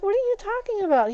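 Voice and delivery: high, fast disbelief; indignant. Retold or imitated. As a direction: push back, offended. Indignant reply to daughter.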